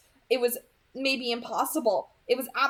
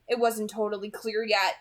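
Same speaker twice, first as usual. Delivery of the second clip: plain reading voice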